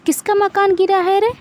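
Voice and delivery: put-on old aunt calling from her house in the rain. A narrator's reading. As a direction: shout the question across the lane. Bua calls out in night.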